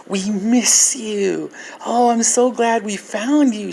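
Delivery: imitating a gushing little girl, high-pitched; mimicked child's delighted greeting; granddaughter's voice. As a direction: draw it out high, like a relieved child. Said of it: "she says".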